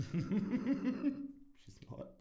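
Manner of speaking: laughing it out in a high voice. Real, not acted. Podcaster insists through laughter that she's not, laughing. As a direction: giggle through the words.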